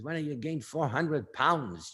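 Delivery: slow New York tough-guy delivery; Cha Cha. Acted. Manager recounting his words to a girl, slow New York accent. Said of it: "Something like this".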